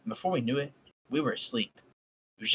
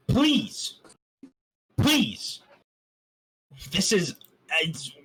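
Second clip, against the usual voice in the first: pleading; 6 semitones higher, much slower than usual. Real, not acted. Begging listeners to be quiet.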